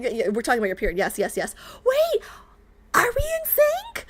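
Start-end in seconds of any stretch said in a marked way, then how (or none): 1.8-4.1 surprised. Real, not acted. Realises periods match.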